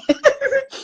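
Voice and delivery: short high-pitched chuckle; brief amused laugh at your own teasing. Real, not acted. Laughs after crying baby jibe.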